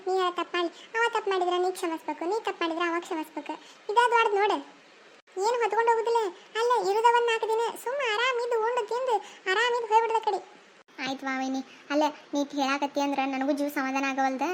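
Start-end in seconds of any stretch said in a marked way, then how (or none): 3.9-10.4 a higher-voiced elder sister-in-law giving advice; advising sister-in-law. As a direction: preach it higher, half-laughing.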